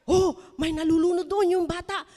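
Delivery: alarmed call. Acted. Teacher Laila spotting the drowning child, alarmed loud call. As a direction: point out the drowning child urgently. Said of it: "nakita ni Teacher Laila yung isang lifeguard".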